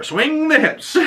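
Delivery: high-pitched, hammed-up urging; mock exhortation. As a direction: push it up high like a silly coach. Mock advice from journal, hammed up.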